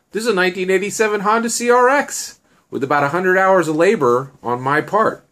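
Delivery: high, loud, excited showing-off; proud boasting. Acted. Shen shows Annie his customized car, boasting proudly.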